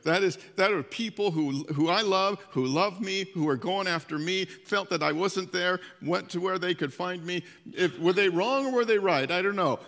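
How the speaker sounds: loud, earnest preaching push; impassioned preaching. Acted. Pastor defending departed members, loud earnest preaching. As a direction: press the point with passion.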